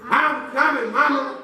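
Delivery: high, eager call to mama; brave little boy. Acted. Boy shouting back to his mama. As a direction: call out like a heroic kid. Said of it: "that little boy said".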